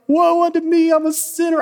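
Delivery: high, hammed-up wailing sinner; a hammed-up cry of "Woe unto me"; mock lament. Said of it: "you were like".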